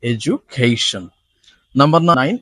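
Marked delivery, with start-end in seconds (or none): 0.0-1.0 এডুকেশন slowly as e-ju-KAY-shun; j pronunciation. Shown as a demonstration. Teacher demonstrates the j sound, careful pronunciation. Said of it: "জয়ের উচ্চারণটা এখানে জ হয়ে যাচ্ছে".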